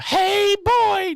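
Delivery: very high hoarse Mickey Mouse voice; Little Bit. Retold or imitated. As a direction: squeak it out like Mickey Mouse after a bender. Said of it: "that was a perfect imitation of Little Bit's voice".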